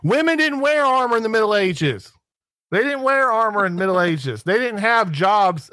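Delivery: high-pitched, insistent debunking; emphatic rebuttal. Acted. Host setting an absent armor reviewer straight, emphatic and exasperated.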